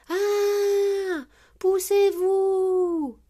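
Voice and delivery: high, loud, drawn-out warning scream; alarmed yell. A narrator's reading. Maman Pig hurtling through town, alarmed yell. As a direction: scream a warning, holding each ending. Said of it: "crie Maman Pig".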